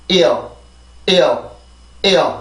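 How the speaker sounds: "ear" stressed at the start, falling away as it relaxes; isolated model "ear", falling, with pauses; tense-then-release demo. Shown as a demonstration. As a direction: hit "ear" then let it glide down. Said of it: "한번 긴장이 되고 나서 풀리면서".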